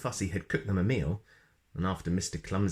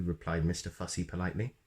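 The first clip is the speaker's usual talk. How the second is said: ordinary delivery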